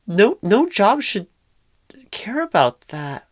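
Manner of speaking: shocked, loud outburst, pitch shooting up. Acted. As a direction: blurt it out in loud disbelief. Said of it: "sorry, uh, if I raised my voice".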